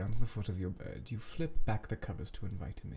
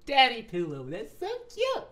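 very high squeal of delight; delighted gushing; 5 dB louder than usual. Real, not acted. Reader gushing over a Danny pillow, delighted squeal. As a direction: squeal over how adorable it is.

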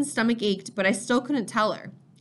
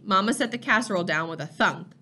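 ordinary delivery

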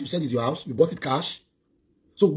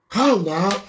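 exasperated challenge; 4 semitones higher, 5 dB louder than usual. Real, not acted.

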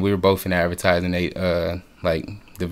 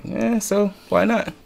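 carefree shrug; 13 semitones higher, slower than usual. Retold or imitated. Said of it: "I was like".

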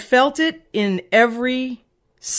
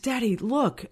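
put-on hushed, higher little-kid voice; voiced mock whisper, pitched up; retold whisper; 4 semitones higher, 6 dB quieter than usual. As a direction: softly imitate a child confiding. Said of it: "I whispered in his ear".

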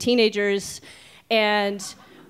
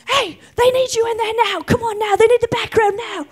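high, urgent voice of a film assistant; hurried crew woman; 12 semitones higher than usual. Retold or imitated. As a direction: rush the kid inside in a high voice. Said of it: "she goes".

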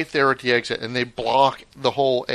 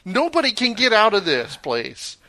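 higher, exasperated complaint; irritated retort; 8 semitones higher than usual. Retold or imitated. Retelling his irritation at people blocking the airport exit, mock exasperated. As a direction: tell them off for blocking the way. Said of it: "It's like".

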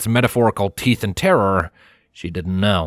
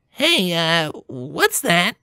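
higher, questioning pony voice; curious Rainbow; 8 semitones higher, much slower than usual. A narrator's reading. Rainbow asking about the approaching cart, curious. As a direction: spot something far off. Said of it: "Rainbow asked".

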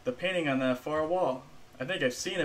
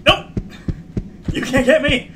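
high, loud frantic cries; panicked; 8 semitones higher, 11 dB louder than usual. Real, not acted. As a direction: scream it while running for your life. Fleeing the monster in panic.

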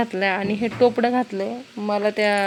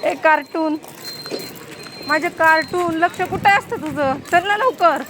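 urging a dawdling son; 8 semitones higher than usual. Real, not acted. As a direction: chivvy him along, raised and impatient. Mother scolding dawdling son.

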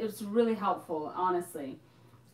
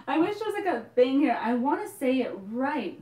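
frustrated; 4 semitones higher, 7 dB louder than usual. Real, not acted. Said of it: "You are still saying it wrong".

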